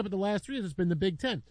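usual speaking voice

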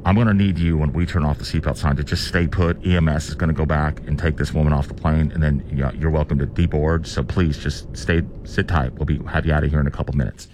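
deep, low airline pilot announcement; smooth pilot on the PA; captain's voice. Shown as a demonstration. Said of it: "I can do a really good captain's voice".

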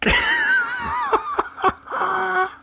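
high-pitched, talking through laughter; real laughing fit. Real, not acted. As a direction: try to keep commenting while cracking up. Still cracking up after the punchline.

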